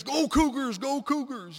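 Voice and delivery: loud, high chanted cheer; teammates' cheer. Retold or imitated. Teammates cheering runners at the race, loud high shout. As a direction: cheer the team on at full voice. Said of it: "then her teammates".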